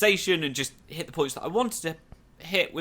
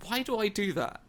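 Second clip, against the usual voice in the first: high, softer self-reproach; puzzled self-questioning; 7 semitones higher, 5 dB quieter than usual. Retold or imitated. Host questioning his own habit, puzzled. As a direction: ask yourself in soft, high bafflement.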